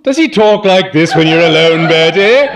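aunt with a carrying voice, demanding; Aunt Dahlia, carrying voice. A narrator's reading. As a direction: boom it across the room like calling cattle. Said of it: "has what you might call a carrying voice".

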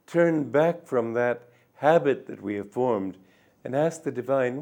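ordinary talk, nothing marked

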